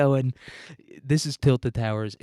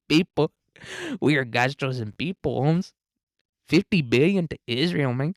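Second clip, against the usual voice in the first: lilting mock Mexican accent, swooping up and down; caricatured sing-song Mexican accent; 4 semitones higher than usual. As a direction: do a hammed-up Mexican accent, lifting the last word. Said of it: "That's what Sheinbaum says to us".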